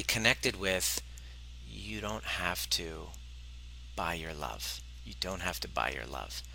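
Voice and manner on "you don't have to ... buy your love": soft inner reassurance. Retold or imitated. Inner reassurance repeated to himself.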